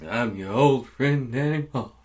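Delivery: slow, lightly voiced old man. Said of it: "that's my old man voice".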